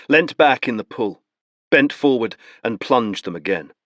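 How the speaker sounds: ordinary talk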